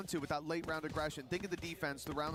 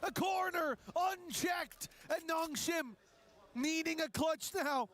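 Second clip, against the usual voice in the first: high, shouting play-by-play call; esports caster; 10 semitones higher, 12 dB louder than usual. Real, not acted. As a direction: call the clutch at full hype. Live play-by-play of clutch.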